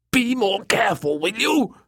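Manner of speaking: high, fussy scolding voice; squawking. Acted. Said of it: "Sunderland squawked again".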